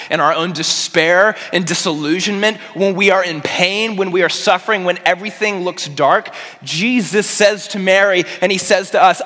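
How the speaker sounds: impassioned, rising in pitch and force; building preaching. Real, not acted. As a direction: build urgently towards a climax. Preacher builds towards Jesus' words.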